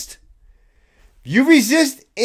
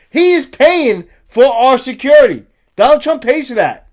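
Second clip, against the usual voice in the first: emphatic; 4 semitones higher, 7 dB louder than usual. Real, not acted. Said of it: "emphatic point".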